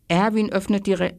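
ordinary delivery, nothing marked

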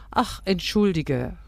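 lightly exclaimed excuse; called apology. A narrator's reading. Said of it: "rief die Schwiegermutter".

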